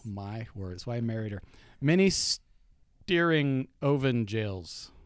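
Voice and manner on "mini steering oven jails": slow sounding out. Acted. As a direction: chew the nonsense syllables slowly. Sounding out game card.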